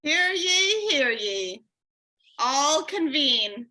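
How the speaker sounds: booming proclamation, drawn out; town crier. Acted. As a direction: call the meeting to order like a herald. Opening a mock official meeting.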